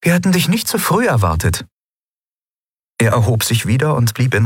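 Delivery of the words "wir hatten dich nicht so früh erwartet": high, lightly amused empress; a touch of amusement in her voice; half amused. Acted. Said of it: "halb amüsiert".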